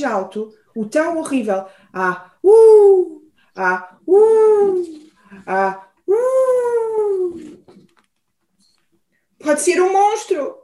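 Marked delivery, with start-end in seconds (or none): 2.4-7.6 loud spooky wail. A narrator's reading. The sound louder. 9.4-10.5 high, squeaky worry; mouse squeak. Acted. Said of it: "chiou o rato".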